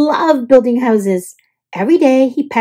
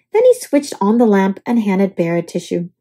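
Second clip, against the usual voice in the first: ordinary delivery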